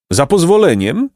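high-pitched, polite interruption; interrupting donkey. A narrator's reading. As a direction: cut in with pompous courtesy. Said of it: "przerwał Patałłach".

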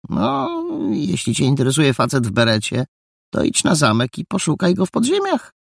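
a touch of superiority. A narrator's reading. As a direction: talk down to him a little. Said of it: "z nutką wyższości".

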